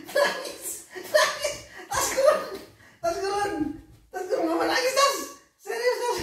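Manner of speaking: high, loud laughing shriek; laughing while talking. Real, not acted. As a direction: call out through helpless laughter. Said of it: "saya sambil ketawa ini". (berseru nyaring sambil tertawa)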